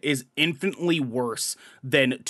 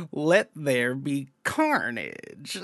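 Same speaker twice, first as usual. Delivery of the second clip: very slow, softer, drawn-out villain line; Woody Harrelson's delivery; 3 semitones lower, 5 dB quieter, much slower than usual. Retold or imitated. Mocking how the actor said Carnage's line, slow and soft. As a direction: draw each word out lazily, almost bored. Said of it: "He says it like".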